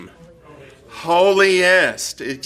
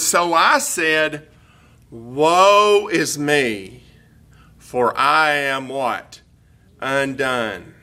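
solemn reading; much slower than usual. Acted. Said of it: "Notice Isaiah's reaction".

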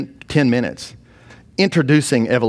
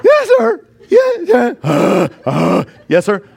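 much higher and louder, laughing yes sir; squeaky scared student; 10 semitones higher, 6 dB louder, much slower than usual. Retold or imitated. Student answering the feared professor, high and laughing.

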